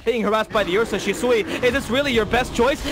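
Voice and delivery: high, loud excited casting; live play-by-play. Acted. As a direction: call every fight as it happens. Live Dota match casting.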